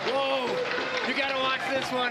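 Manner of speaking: high, loud excited shout. Real, not acted. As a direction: shout with amazement. Reacting to big play.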